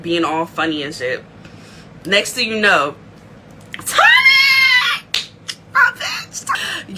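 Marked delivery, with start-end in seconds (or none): 3.9-7.0 hype shout. Real, not acted. Hype at Tommy's arrival.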